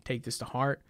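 soft, fast fake-caring voice; hushed, hurried mock honesty; mock sincere. Voicing fake honest girlfriend.

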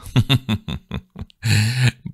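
short high bitter laugh. A narrator's reading. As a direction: laugh in scorn. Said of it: "risa amarga".

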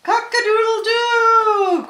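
very high, loud crow with long last note; full-out storybook cock-a-doodle-doo; rooster crow. A narrator's reading. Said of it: "crowed the roaster".

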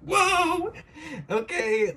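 high, loud words through laughter; laughing shriek. Real, not acted. Reacting to her drawing, laughing high shriek.

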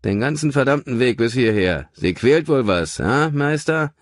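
a hint of a gruff cab driver; lightly voiced cabbie. A narrator's reading. Taxi driver asking his passenger the house number. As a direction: play the chatty taxi driver.

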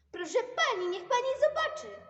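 loud, high excited call. Acted. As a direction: shout to the teacher. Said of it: "zawołał".

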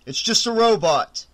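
loud shout over the panic; loud, shouted reassurance. A narrator's reading. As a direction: shout it to calm everyone down. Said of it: "Doug shouted".

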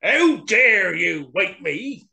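loud, slow lion snarl; angry snarl. A narrator's reading. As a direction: draw it out as an outraged lion, high and loud. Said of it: "the lion snarled".

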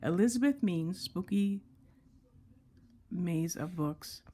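slow and deliberate, with a pause before the last words. Real, not acted. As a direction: say the title slowly, piece by piece. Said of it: "let me say this slower".

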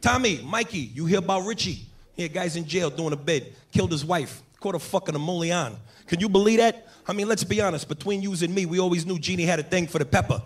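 put-on wiseguy gossip to his boys; lightly voiced Brooklyn Italian spinning a tale; Italian-American storyteller. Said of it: "he starts telling this story to his boys".

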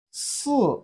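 "si" starting high, dropping sharply; long s, then let "si" fall from high. Shown as a demonstration. Teacher models Chinese four for learners, falling "si". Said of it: "is the falling tone".

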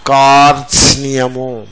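కార్త్స్నియము slowly, r-t-s-n-y all sounded; long kaar, distinct t-s-ni-ya in కార్త్స్నియము; all five consonants. Shown as a demonstration. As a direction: draw out కార్త్స్నియము for students to hear each consonant. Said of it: "మళ్ళీ వినండి".

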